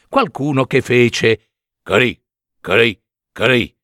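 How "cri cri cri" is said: low chirping cri cri; cricket chirp. A narrator's reading. Narrator voicing the cricket's chirp.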